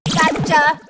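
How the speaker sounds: triumphant robot shout. Acted. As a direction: yell it out gleefully. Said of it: "shouts Robo Pete".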